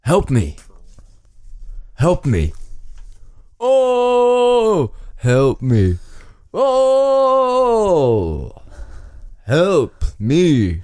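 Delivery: hammed-up cries with high, stretched Ohs; mock wailing for help; stranded man's plea. Stranded man begs listeners, hammed-up wailing. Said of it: "convey this message".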